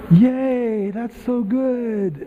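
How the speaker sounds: slow, high, forced mock cheer that fades; half-hearted praise. Family praising an eight-year-old's bad singing, half-hearted. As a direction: praise dutifully with the enthusiasm draining away. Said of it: "when they were eight or nine".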